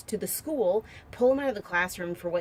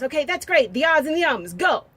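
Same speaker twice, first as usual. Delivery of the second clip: hammed-up teacher announcement; 8 semitones higher, 10 dB louder than usual. Teacher announcing team names.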